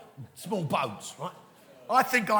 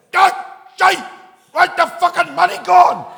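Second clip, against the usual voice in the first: very loud, high-pitched impression of a prime minister; Gordon Brown; 12 semitones higher, 18 dB louder than usual. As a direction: bellow it as a hammed-up politician impression. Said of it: "my impression of Gordon Brown".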